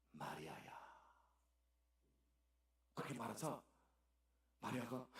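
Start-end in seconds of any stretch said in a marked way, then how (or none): 0.1-0.8 much lower, soft and gentle; tender call. Acted. Jesus gently calling Mary by name, low and soft.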